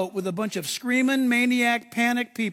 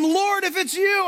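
high, slow, drawn-out call; acted high-pitched hail; 10 semitones higher, 4 dB louder than usual. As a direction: call across the water to your Lord. Peter calling to Jesus on water.

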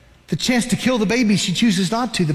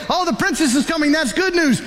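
cheerful; 6 semitones higher than usual. Retold or imitated. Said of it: "Some of you say".

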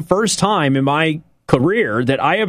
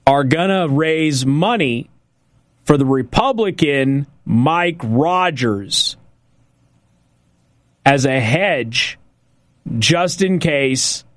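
slow, deliberate phrases with pauses; slowly. Shown as a demonstration. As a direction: let each phrase sink in, unhurried. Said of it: "I'm gonna say that again slowly".

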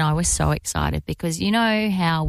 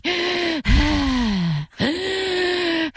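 imitated panting gasp; 10 semitones higher than usual. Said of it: "he was like".

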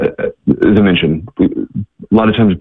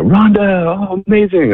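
high, drawn-out cheer without much volume; joyful mock shout; 13 semitones higher than usual. Missionaries greet long-missed Rhonda, joyful high mock shout. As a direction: imitate friends calling out in delight, pitch soaring. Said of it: "shout, you know".

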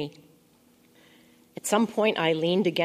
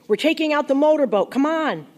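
high, loud, hurried call; shouting; 9 semitones higher, 7 dB louder than usual. A narrator's reading. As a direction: holler it through a closed door. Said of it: "Eli shouted".

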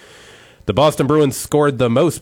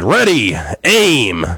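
higher, louder barked command; captain's shout; 3 semitones higher, 5 dB louder, much slower than usual. Retold or imitated. Captain commanding the firing squad, loud mock command. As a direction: call the firing squad to ready. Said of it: "the captain of the guard shouted".

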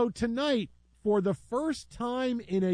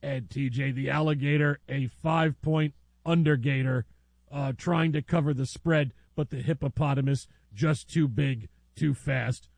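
steady sportscaster call, lower; mock play-by-play; 5 semitones lower than usual. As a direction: call it like a live game broadcast. Mock sports call of hippo fight.

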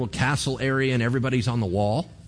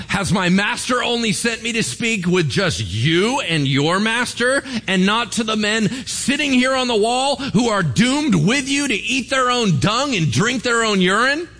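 higher, building mock bellow; louder bully; 9 semitones higher, 3 dB louder than usual. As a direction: shout back at the wall, getting louder. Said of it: "He just gets louder".

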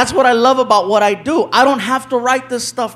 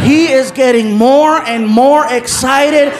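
ordinary talk

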